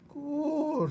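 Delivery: put-on high, loud girl mishearing; high rough girl voice; Mig's voice. A narrator's reading. Mig voiced in story.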